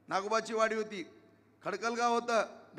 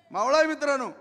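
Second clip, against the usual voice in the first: higher, louder call of मावळा आहे; rousing repeat; 4 semitones higher, 7 dB louder than usual. Real, not acted. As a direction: throw मावळा आहे out to the crowd. Rally speech emphatic repetition.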